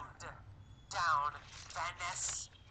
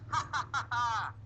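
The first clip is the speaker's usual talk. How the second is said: higher, louder staged "ha ha"; mock laughter; 6 semitones higher, 5 dB louder than usual. Storyteller voices Alan laughing at pulled-over Tom, mock laugh. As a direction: act out a triumphant mocking laugh. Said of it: "Alan was laughing".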